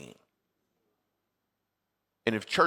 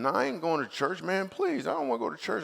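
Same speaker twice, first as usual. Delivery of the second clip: whiny pleading; 7 semitones higher than usual. Retold or imitated. Pleading refusal of invitation.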